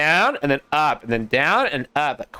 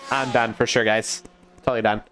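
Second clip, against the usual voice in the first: ordinary talk, nothing marked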